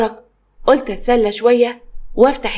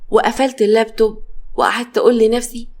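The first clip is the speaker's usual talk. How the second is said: ordinary delivery, nothing marked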